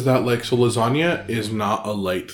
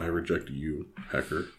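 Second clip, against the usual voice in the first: much lower, soft comeback; low muttered retort; 7 semitones lower, 11 dB quieter than usual. Pretend. Co-host answering the sung rejection, low mutter. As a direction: drop it low and quiet.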